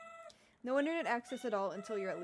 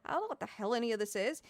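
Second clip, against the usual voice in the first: ordinary talk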